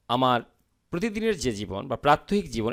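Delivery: ordinary talk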